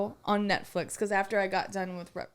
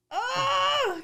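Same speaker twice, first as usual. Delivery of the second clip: shudder out a high oh; a high yelp of dread at the thought; horrified cry. Real, not acted. Horror at storage container thought.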